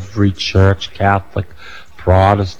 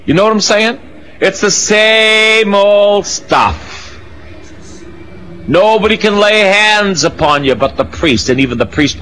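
fed up, let it ring out; indignant outcry; 12 semitones higher than usual. Real, not acted. Preacher venting about priest-only rules, high and exasperated.

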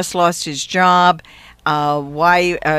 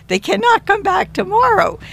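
a pleading, insistent high push on tomorrow; insistent retelling; 14 semitones higher than usual. Retold or imitated. Retelling how she pressed Mary on the phone, insistent and high. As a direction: pitch jumps way up, firmly insisting it can't wait.

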